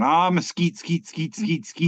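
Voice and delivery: lightly hyped rapper catchphrase; a hint of a party-rapper ad-lib. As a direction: draw out the first word, then chant the rest. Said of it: "it's a picture of [name]".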